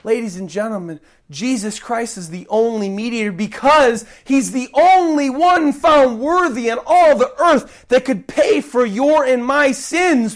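normal talking voice

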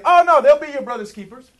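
loud, high mock reassurance; sarcastic. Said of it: "That's my sarcasm".

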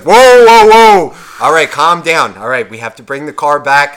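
loud panicked whoa, then calming; alarmed shout. Retold or imitated. Buddy yelling at his friend to calm down, loud alarm.

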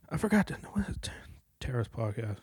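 unvoiced whisper while searching memory; whisper to self. Real, not acted. Mutters a whisper to himself while recalling the podcast name. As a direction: breathe it out, half to yourself.

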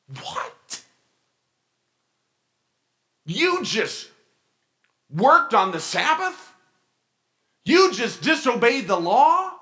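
high, loud scandalised Pharisee; mock gasp of outrage, rising loud; outraged disbelief. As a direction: play the shocked, indignant rule-keeper. Pharisees outraged at hungry disciples.

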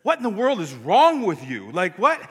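high, loud mock-appalled demand; exasperated disbelief. Wife texts her husband mid-sermon, in mock exasperated disbelief. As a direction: act out a baffled scolding. Wife's shocked text acted out.

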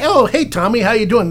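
high, eager hello with the name; cheery name-drop greeting. Shown as a demonstration. Colleague cueing Ron with the visitor's name, cheery and high. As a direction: jump in brightly to feed him the name. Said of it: "then you would say".

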